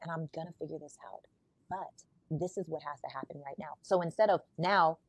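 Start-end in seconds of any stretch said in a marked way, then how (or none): 0.0-3.8 much softer, gentle soothing talk, ending in a whisper; calm her down quietly; soft reassuring partner. Retold or imitated. Voicing calm leader to partner.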